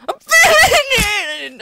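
very high, loud cry; real screaming cry; pained scream. Real, not acted. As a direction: scream it out as the hit lands. Gamer screams at a hit.

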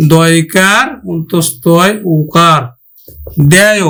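দেয় built up slowly from দয়কার অন্তস্থ ওকার; slow spelled-out word. Shown as a demonstration. Teacher to students, slow spelling of দেয়. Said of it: "উচ্চারণ করব কিন্তু".

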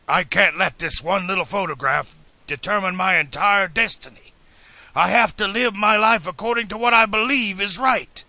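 high, loud, impassioned insistence; adamant. A narrator's reading. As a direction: declare your conviction, refusing to budge. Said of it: "But the inventor was adamant".

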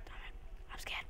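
breathless frightened whisper; scared whisper. Real, not acted. Player spooked by a noise, whispering scared. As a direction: whisper it like something might hear you. Alone in dark game house.

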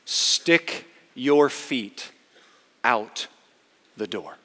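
very slow, a pause between phrases; solemn, spaced-out charge; deliberate. Acted. Six words delivered one by one.

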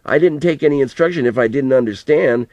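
understated angry cry; frustrated anger. A narrator's reading. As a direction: push back at grandpa, a little hurt and cross. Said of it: "cried in a frustrated anger".

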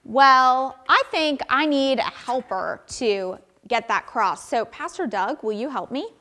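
ordinary talk

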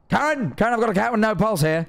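quoting the advert man in alarm; Specsavers ad guy. Retold or imitated. Quoting the Specsavers advert man, calling out alarmed. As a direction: call out KAREN high, then the line urgently. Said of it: "That's how he says it".

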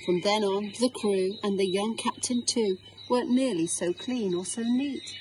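ordinary delivery

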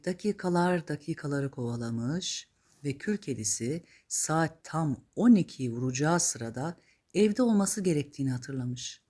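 usual speaking voice

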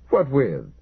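a terse, pushy challenge; demanding. Acted. The fire commander demands to know how, mildly insistent. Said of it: "the fireman demanded".

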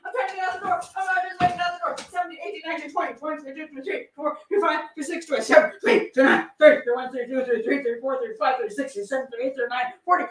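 rapid, high-pitched rattled-off count; urgent re-enactment count; frantic counting. Acted. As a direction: count in a breathless rush, as if racing the clock. Acting out hundred rapid stabs against clock.

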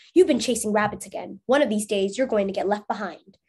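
ordinary delivery

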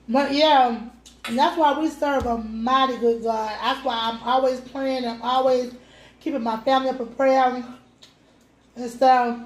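speaking with food in mouth; talking between bites; chewing while talking. Real, not acted. Seen 1: woman picks at food, bites at 2.3, keeps talking.

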